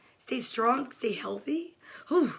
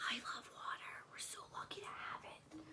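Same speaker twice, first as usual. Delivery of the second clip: breathy whispered aside; hushed whisper about water; 25 dB quieter than usual. Real, not acted. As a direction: whisper it like a secret. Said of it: "whispered aside".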